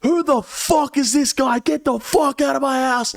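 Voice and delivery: high-pitched mock rant; exaggerated high voice raging at the intruders; mock thrashing out. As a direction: squeal it like an overblown tantrum. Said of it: "I'm thrashing out".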